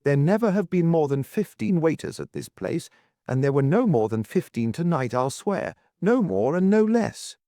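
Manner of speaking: high, excited insistence; excited cry. A narrator's reading. Duke insisting on his memory, excited. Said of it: "cried the duke excitedly".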